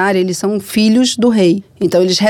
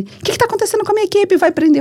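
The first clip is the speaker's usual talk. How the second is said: high, hurried worried questioning; retold alarm; 13 semitones higher, faster than usual. Hernani fretting that the crew will be arrested, high alarm. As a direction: imitate someone fretting in a raised pitch.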